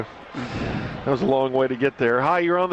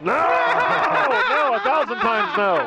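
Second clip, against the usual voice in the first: laughing no; 10 semitones higher, much slower than usual. Real, not acted. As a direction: shout it down through laughter. Host reacts to crude caller vote.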